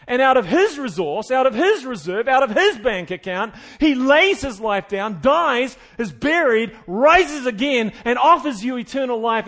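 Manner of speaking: loud, driving sermon build; fired-up preacher rising to a climax; preaching at full force. Acted. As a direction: hammer the point home from the pulpit.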